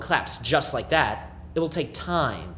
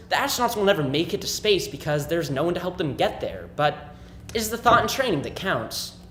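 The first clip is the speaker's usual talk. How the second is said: ordinary delivery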